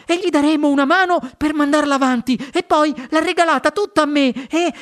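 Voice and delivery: bursting with news; excited boy. Acted. Charlie excited voice. (ragazzo eccitato, voce alta)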